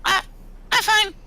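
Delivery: very high, squeaky little creature voice; small scared creature. Acted. Small character Gitmo answers in-game.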